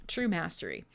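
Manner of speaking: quiet afterthought; hushed echo. Acted. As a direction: say it again under your breath. Repeated softly as echo.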